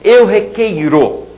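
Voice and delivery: "requeiro" said slowly, every syllable; slow demo. Shown as a demonstration. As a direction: stretch out "eu requeiro". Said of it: "Pega o presente indicativo". ("eu requeiro" bem devagar)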